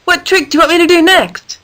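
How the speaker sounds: calling out eagerly to her friend. A narrator's reading. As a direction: call out brightly across the ring. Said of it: "Danielle called out to her".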